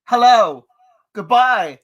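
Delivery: loud calls to hear the echo; shouting as noise stops. Real, not acted. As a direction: call out loud to test the echo. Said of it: "Did you guys hear that echo?".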